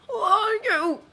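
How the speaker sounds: high, loud wheezing fright; terrified wheeze. Acted. Choked director asks Jake, high terrified wheeze. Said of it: "the man wheezes".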